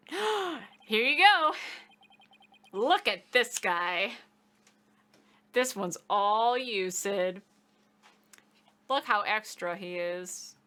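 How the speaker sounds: slow, loud, theatrical showboating; extra, over the top. Pretend. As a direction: ham it up big and slow for the camera. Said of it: "Pull out all one hundred and ten percent extra".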